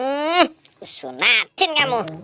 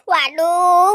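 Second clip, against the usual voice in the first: ordinary talk, nothing marked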